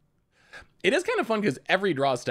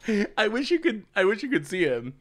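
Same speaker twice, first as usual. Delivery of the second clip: ordinary talk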